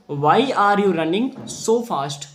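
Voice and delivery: very slow, word-by-word reading; slow classroom reading; slow plain reading. Shown as a demonstration. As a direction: read the line out slowly for the class. First reading of jackal's line.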